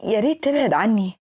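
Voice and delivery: plain reading voice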